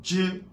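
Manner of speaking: "Dieu" said like "djeu", high; Chinese zh. Shown as a demonstration. Teacher showing zh for students, Chinese pronunciation. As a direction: model zh as "dje" high. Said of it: "qui est Dieu".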